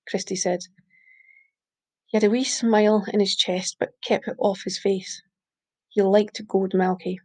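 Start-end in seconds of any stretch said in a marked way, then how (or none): none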